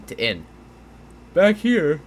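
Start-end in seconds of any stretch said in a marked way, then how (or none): 1.3-2.0 excited. Real, not acted. Sudden realisation on the map.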